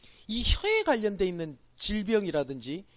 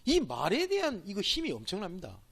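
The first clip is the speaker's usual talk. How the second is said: ordinary talk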